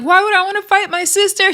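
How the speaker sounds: high-pitched imitation of a breakdown; mock crying. Krishan in tears over her sister, mock crying. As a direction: whine it as if breaking down. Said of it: "she's breaking down crying".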